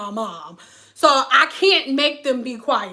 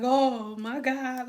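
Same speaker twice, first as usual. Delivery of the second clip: stunned oh my god; 6 dB quieter, much slower than usual. Retold or imitated. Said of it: "I was like".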